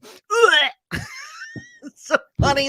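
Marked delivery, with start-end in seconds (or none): 0.0-0.7 high, loud retching "ugh" like a cat dry heaving; imitated cat gag. Host imitating a cat gagging at a shrimp, loud gag. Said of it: "she goes". 0.8-1.6 a high, wild laughing shriek. Real, not acted. Host cracking up at her own cat impression, high shrieking laugh.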